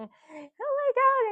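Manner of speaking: high, slow, drawn-out mock alarm; hammed-up shocked churchgoer; mock gasp. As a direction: send up a panicked believer's gasp. Mocking panicked religious people.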